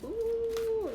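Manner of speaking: high, drawn-out ooh of surprise; a high wondering ooh at the card; surprised ooh. Real, not acted. Reader reacting to the card, surprised ooh.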